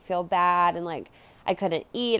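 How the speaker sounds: ordinary delivery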